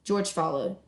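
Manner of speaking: ordinary delivery, nothing marked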